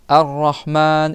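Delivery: slow "Ar-Rahman" with mid-throat ha; Arabic ha. Shown as a demonstration. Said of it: "Au milieu de la gorge".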